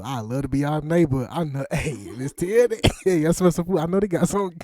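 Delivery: high, loud playful calling out; excited neighbor. Pretend. Interviewer pretends to drop by Miss Tierra's house, excited calling out. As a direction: call over like a nosy neighbor at the door.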